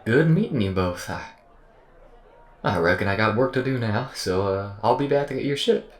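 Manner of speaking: loud, high folksy voice, tripping over words; awkward, over-cheery goodbye full of false starts; stammering AI character. Acted. AI excuses itself to go back to work, stammering and awkward. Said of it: "programmed it to stammer".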